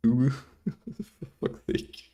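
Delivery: wheezing laugh breaking through the words, high and slow; laughing exasperated. Real, not acted. Streamer laughing at his chat, exasperated laugh. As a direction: laugh it out in disbelief.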